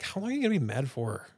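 high, whiny, put-on voice to the wife; mock whiny plea. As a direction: whine it up high like a teasing complaint. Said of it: "I'm like".

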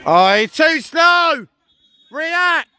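loud frustrated yell at a player; sideline shout. Real, not acted. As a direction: bellow it across the pitch.

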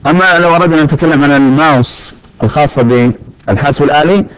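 normal talking voice

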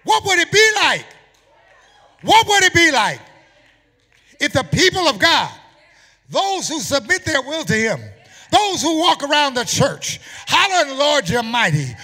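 high, loud preacher's shout; roaring sermon climax in driving phrases; full-force preaching. Acted. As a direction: shout it out to the congregation.

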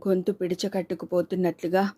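ordinary delivery

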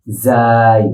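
যাই with buzzing z and drawn-out glide; pronunciation demo. Shown as a demonstration. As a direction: stretch যাই as a model to repeat. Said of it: "কি উচ্চারণ হবে?".

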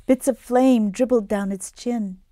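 ordinary delivery, nothing marked

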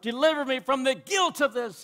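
acted plea to God; crying out. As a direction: plead for deliverance. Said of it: "And he cries out".

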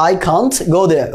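"I can't go there" drawn out slowly; slow it right down for learners; very slow demo. Shown as a demonstration. Teacher repeats the model sentence slowly. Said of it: "এইভাবে উচ্চারণ করতে হবে".

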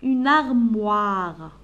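Une armoire drawn out slowly; slow model word. Shown as a demonstration. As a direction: stretch out Une armoire for pupils. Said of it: "wa, armoire". (Une armoire ببطء شديد)

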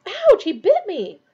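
high, loud, drawn-out outcry; pained yelp. A narrator's reading. Ron is bitten by his rat, yelping in complaint. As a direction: cry out at a sudden bite.

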